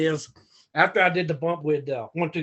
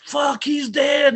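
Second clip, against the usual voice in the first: slow, high, loud alarmed yell; a drawn-out shout of horror; panicked shout; 9 semitones higher, 5 dB louder, much slower than usual. Retold or imitated. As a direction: yell it like you think he's dead. Wrestlers panicking watching the bump.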